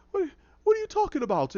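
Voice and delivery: high, stammering bewilderment; flustered denial. Retold or imitated. Captive questioned by the Penguin, flustered. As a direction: squeak out a confused protest.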